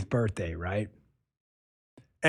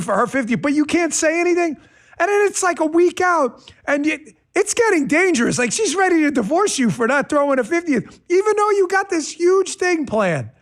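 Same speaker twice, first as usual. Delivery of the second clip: excited; 12 semitones higher, 3 dB louder than usual. Real, not acted. Excited storytelling, rising stakes.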